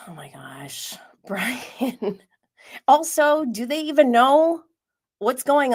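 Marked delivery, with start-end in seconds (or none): 0.1-2.2 hushed, giggling aside; amused whisper. Real, not acted. Reacting to Brian on screen.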